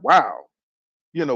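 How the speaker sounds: ordinary delivery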